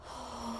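long soft breath; a slow, audible calming breath; deep breath. Shown as a demonstration. Host leading listeners in a calming exercise, deep breath. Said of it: "Deep breath".

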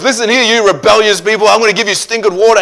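high, loud scolding; hammed-up exasperated tirade; acted angry rebuke. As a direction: lay into a crowd as fed-up Moses. Moses angrily scolding the rebellious people.

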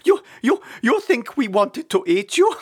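high, loud words broken by laughter; helpless, stuttering laughter through speech; laughing uncontrollably. A narrator's reading. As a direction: barely get the words out for laughing. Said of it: "could hardly get his words out as he laughed uncontrollably".

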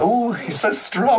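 high, slow swooning admirer; mock cooing. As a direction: coo in exaggerated admiration. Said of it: "like, cooing".

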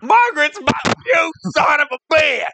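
high, anguished angry cry; hammed-up jealous rage; intense outburst. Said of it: "that was a little bit, uh, intense".

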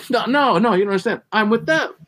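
high insistent pleading. Retold or imitated. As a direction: beg the cop to listen. Pleading with cop.

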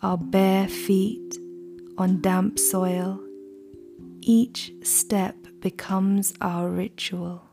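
slow, hushed ritual chant; incantation. Acted. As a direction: intone it like an ancient invocation. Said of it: "the poem itself is read almost like an incantation".

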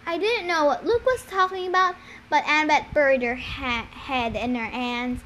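usual speaking voice

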